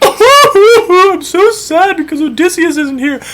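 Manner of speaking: very high, slow, whiny lament; Penelope hamming up her sorrow in a high voice; mock wailing queen. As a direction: overplay the grieving wife.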